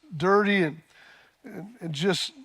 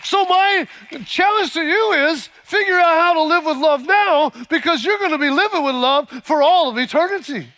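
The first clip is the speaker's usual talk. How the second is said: high, loud ringing challenge; preaching at full force; 10 semitones higher, 7 dB louder than usual. Acted. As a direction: call the congregation to live with love. Rousing preaching close.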